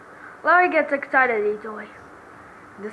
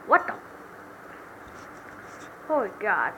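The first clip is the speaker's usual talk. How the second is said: ordinary talk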